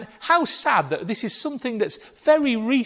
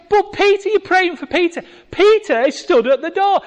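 higher, louder urgent plea; insistent girl; 12 semitones higher, 8 dB louder than usual. Retold or imitated. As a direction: press the point, breathless and eager. Rhoda insisting again.

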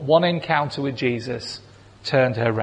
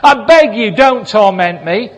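much louder, higher, fast, desperate outburst; loud pleading cry; 5 semitones higher, 8 dB louder than usual. A narrator's reading. Demon-possessed man crying out to Jesus, loud desperate plea. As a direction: cry out begging not to be tormented. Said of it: "said in a loud voice".

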